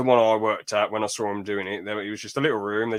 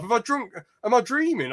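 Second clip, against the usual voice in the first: baffled disbelief; 11 semitones higher than usual. Retold or imitated. Retold baffled inner thoughts.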